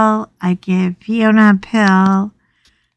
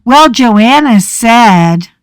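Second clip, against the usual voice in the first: mock quote; 3 semitones higher, 6 dB louder, slower than usual. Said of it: "go running off and go".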